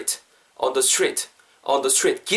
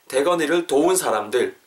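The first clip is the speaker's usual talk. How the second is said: usual speaking voice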